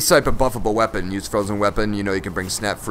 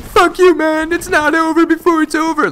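high squeaky whiny voice, much louder; mock angry opponent; 5 dB louder than usual. Gamer voicing his angry opponent in a high whiny squeal. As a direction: play the sore loser in a put-on squeal. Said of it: "He's like".

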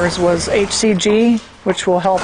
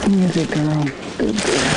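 soothing a horse; 4 semitones lower than usual. Real, not acted. Calming mare during procedure.

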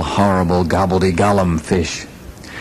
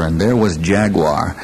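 ordinary delivery, nothing marked

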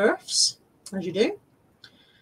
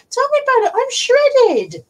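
exaggerated complaint; 12 semitones higher, 4 dB louder, slower than usual. Real, not acted. Dog scratched her arms.

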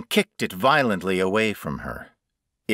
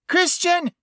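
very high, loud shriek of his name; panicked shriek; 5 dB louder than usual. A narrator's reading. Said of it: "she shrieked".

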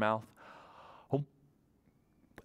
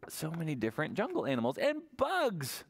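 ordinary delivery, nothing marked

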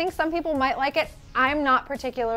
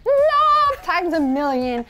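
gushing; 6 dB louder, slower than usual. Real, not acted. Host raving about a favorite look, gushing. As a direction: belt out one long, gushing "love".

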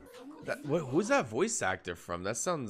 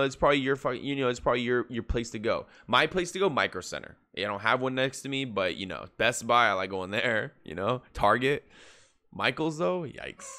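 ordinary delivery

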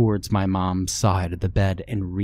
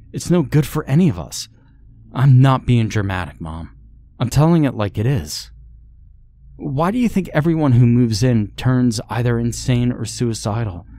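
higher, louder pleading yell; yelling into phone; 4 semitones higher, 4 dB louder than usual. Acted. Daughter yelling at her mom on the phone, desperate. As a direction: shout down the phone at her mother. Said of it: "she yelled into the phone".

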